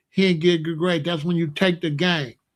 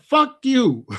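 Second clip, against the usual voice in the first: mock baby voice cursing back; a hint of a baby's defiant voice; baby talk; 5 semitones higher, 4 dB louder than usual. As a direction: play the defiant toddler lightly. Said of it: "And he said".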